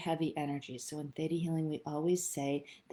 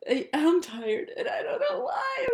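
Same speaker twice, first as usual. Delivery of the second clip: mock distressed cry, much higher and louder; freaking out; 13 semitones higher, 5 dB louder than usual. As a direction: panicky wail, high and loud. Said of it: "you start going".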